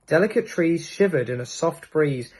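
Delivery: ordinary delivery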